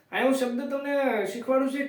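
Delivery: normal talking voice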